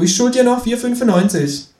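high, cheeky kangaroo voice. Acted. Kangaroo asks narrator to shove over, high cheeky. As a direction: pitch way up for the cheeky marsupial.

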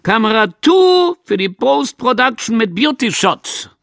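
high, loud announcement to the crew; supervisor calling out. A narrator's reading. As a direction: sing out the camera order loudly. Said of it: "und gerufen". (hoch und laut gerufen)

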